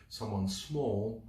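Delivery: ordinary delivery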